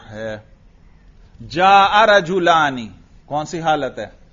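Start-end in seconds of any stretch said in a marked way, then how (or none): 1.5-2.9 جاء رجلانی slow and loud, with a long laa; clearly pronounced alif. Shown as a demonstration. Teacher testing which case it is, drawn out. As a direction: project جاء رجلانی, stretching its long vowels. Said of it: "تلفظ سے آپ کو پتہ چل گیا".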